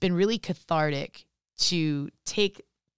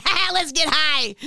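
goofy joking; 10 semitones higher, 7 dB louder than usual. Retold or imitated. Jokey self-quote after "I'm like.

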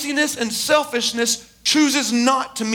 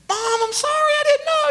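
put-on very high, whiny kid apology; child's voice; 13 semitones higher than usual. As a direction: plead like a little child in trouble. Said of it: "he was a child".

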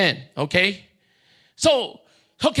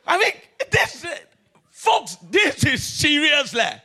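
laughing; 3 semitones higher than usual. Real, not acted. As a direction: laugh through mock outrage. Laughing mock outrage.

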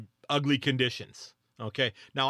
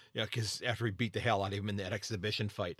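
ordinary delivery

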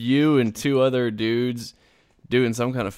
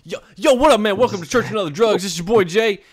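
high, loud, over-hyped welcome; mock a hyped-up pastor greeting the crowd; mocking youth pastor; 8 semitones higher, 6 dB louder, faster than usual. Host mocks his co-host's church intro, hyped-up and high. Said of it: "heard me mock what you sounded like".

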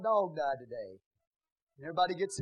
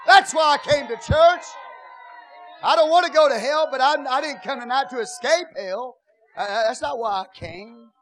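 shouted, higher, much louder sermon; full-force preaching; 8 semitones higher, 14 dB louder than usual. Acted. Pastor exhorting the congregation, shouted full-force preaching. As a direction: preach it hard to the church.

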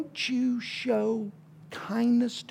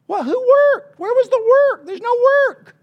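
much higher, louder mock disbelief; incredulous; 8 dB louder than usual. Preacher asking the congregation who worked, in mock disbelief. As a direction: ask as if the idea is absurd.

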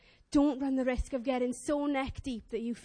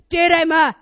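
loud, commanding dog-owner voice; authoritative command; 2 semitones higher, 12 dB louder than usual. Retold or imitated. Owner ordering her dog back, authoritative. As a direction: put on the voice of authority. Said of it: "You know that voice you put on?".

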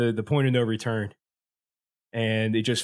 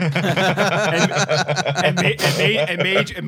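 laughing; 5 semitones higher, 4 dB louder, much slower than usual. Real, not acted. Laughing through explanation.

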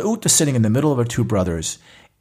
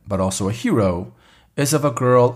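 usual speaking voice

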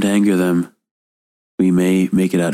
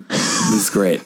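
unenthused; much slower than usual. Acted. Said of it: "not stoked, but, like".

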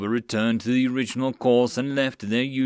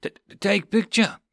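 nervous stammer; 8 semitones higher than usual. Acted.

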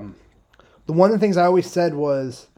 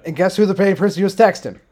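ordinary talk

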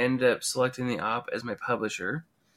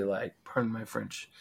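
ordinary talk

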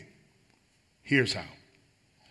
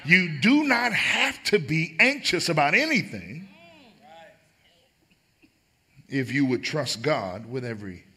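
rising preacher's delivery, stress on not; preaching. Shown as a demonstration. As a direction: preach it to the crowd. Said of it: "let me just stand and preach for just a moment".

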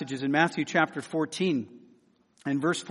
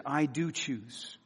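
tender resolve; 3 semitones lower, 8 dB quieter, much slower than usual. Acted. Preacher voices Jesus moved by compassion.

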